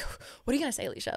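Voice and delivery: panicked whisper to herself. Retold or imitated. Panicked self-talk before meeting.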